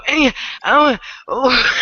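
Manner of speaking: startled gasping. Retold or imitated. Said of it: "you'd hear people go".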